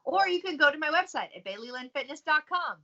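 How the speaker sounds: high, loud out-of-touch boomer; baby boomer voice. Pretend. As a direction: play an old boomer reading a web address. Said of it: "That's her baby boomer impression".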